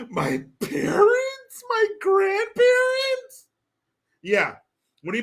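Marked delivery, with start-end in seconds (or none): 0.1-3.5 much higher, very slow squeaky mock question; mock squeak. Streamer mocking a clueless question, high and slow. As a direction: squeak it out in disbelief.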